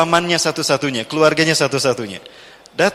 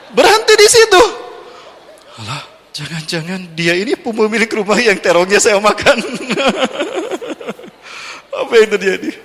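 laughing; 6 semitones higher than usual. Real, not acted. Comic surprise, laughing.

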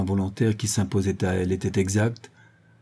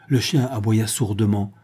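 plain reading voice

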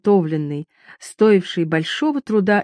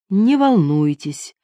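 slow, drawn-out reassurance; measured, leisurely answer; slow reply; 2 semitones higher, slower than usual. A narrator's reading. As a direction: stretch it out unhurriedly. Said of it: "медленно ответила Туанетта".

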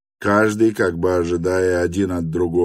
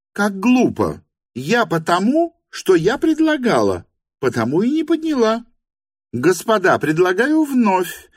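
very high, indignant hostess voice; scold, then lay down the rule, high-pitched; irritated hostess; 12 semitones higher, 3 dB louder than usual. A narrator's reading. Hostess explains the vote afresh, high irritated voice.

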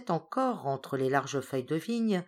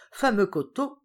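higher, louder relish; pleased exclaim; 5 semitones higher, 5 dB louder than usual. A narrator's reading. Hippel pouring another glass, pleased and loud. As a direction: toast the hillside. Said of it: "reprit Hippel".

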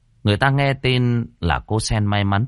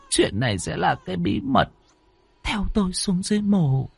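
higher, hushed vow; landlady vowing to keep the baby; landlady's solemn vow; 7 semitones higher than usual. Acted. Landlady voiced declaring secret.

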